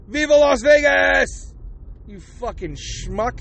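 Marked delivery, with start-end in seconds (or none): 0.1-1.5 mock shout. Mocking the player bellowing his victory speech, slow. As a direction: bellow it like a rowdy celebration.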